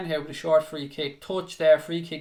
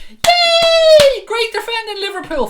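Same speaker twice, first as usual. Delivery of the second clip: shrill-high sarcastic squeal of praise, much louder; mock celebration of bad defending; mock cheer; 12 dB louder, slower than usual. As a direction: gush fake praise, dripping sarcasm. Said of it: "blow smoke up their arse and say".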